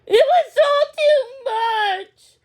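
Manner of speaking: high, loud, slow sobbing cry; breaking into tears. Acted. Daisy telling Millie it was too much, crying. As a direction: let the voice break.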